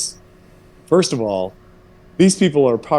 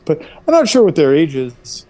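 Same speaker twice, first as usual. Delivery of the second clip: higher, emphatic I'm NOT sure; coy evasion again; 4 semitones higher, 4 dB louder than usual. Shown as a demonstration. Son to mom, pretending ignorance. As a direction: land the not with a wink. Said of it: "I do know what their age is".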